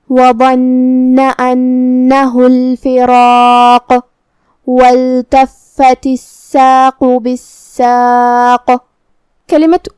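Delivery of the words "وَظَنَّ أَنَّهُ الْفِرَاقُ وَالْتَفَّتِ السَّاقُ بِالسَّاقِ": slow melodic chant, heavy را and bouncing قاف; Quran recitation. Shown as a demonstration. Teacher recites the verses for students, slow chant. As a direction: recite slowly with qalqala. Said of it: "قاف کو قلقلہ سے ادا کرنا ہے".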